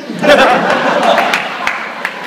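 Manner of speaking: a sudden high breathy laugh; burst of laughter. Real, not acted. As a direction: laugh out loud at the memory. Laughing after wife's jabbing.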